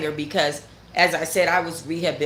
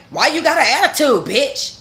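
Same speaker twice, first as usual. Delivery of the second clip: louder, higher, sassy challenge; mock confrontational yell; 7 semitones higher, 5 dB louder than usual. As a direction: throw the line at her like a dare. Voicing her own hypothetical retort.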